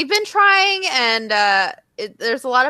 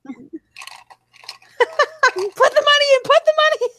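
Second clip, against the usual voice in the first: squeaky high-pitched imitation, louder; high mock voice; 5 dB louder than usual. Friend mimics the casino scene in a squeaky high voice. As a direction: squeak it out like a cartoon.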